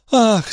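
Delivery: a high gasp of relief; mother seeing her son thrown clear, high relieved gasp. Acted. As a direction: let the fear go in one gasp. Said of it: "с облегчением вздохнула Андре".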